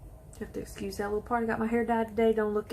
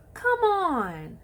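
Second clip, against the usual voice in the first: high, loud come on of disbelief; excited; 9 semitones higher, 4 dB louder than usual. Real, not acted. Thrilled over her setting powder, excited high exclaim. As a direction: squeal it out in delight.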